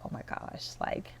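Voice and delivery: very soft, nearly whispered aside; hushed dread. Acted. Dad pretending to be asleep as son showers, hushed. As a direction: barely voice it, as if feigning sleep.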